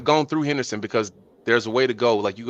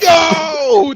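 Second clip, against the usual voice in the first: loud, high cry of disbelief; shocked outburst; 7 dB louder than usual. Real, not acted. Former boss hearing the confession, shocked outburst. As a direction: yell it out, amused and scandalised.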